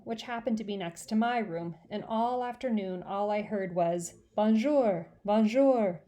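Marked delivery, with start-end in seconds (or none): 4.3-6.0 loud squawky mynah bonjour; bird voice. Acted. Mynah bird calling through the wall. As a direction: call it like a talking bird. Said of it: "in bird voice".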